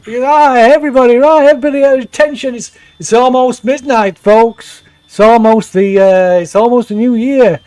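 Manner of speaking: a tipsy label boss working the crowd; drunk MC. Acted. Drunk host on party mic.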